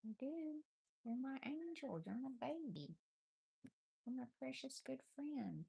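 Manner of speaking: high doting pet voice. Real, not acted. Baby talk to the cat.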